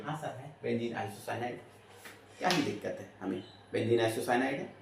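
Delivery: lightly voiced student, low and soft; agreeing student. Teacher answering as his students would, meekly agreeing. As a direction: murmur it back like a compliant pupil. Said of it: "आप बोलोगे".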